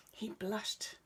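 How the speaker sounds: plain reading voice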